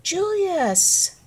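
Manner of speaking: high, loud call, falling at the end; crying out. A narrator's reading. Circus people find lost Julius, crying out his name. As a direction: call out the name as a whole crowd would. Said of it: "cried everybody".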